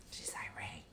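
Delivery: very quiet mock whisper; gossipy whisper. Mimicking workmen talking about the lady, mock whisper. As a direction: whisper it like gossip behind someone's back. Mimicking what the men say of her.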